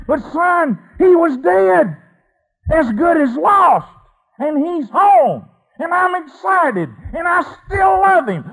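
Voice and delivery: a father shouting his love; pleading shout. Acted. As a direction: cry out to the son, begging him to understand. Father pleads with older son.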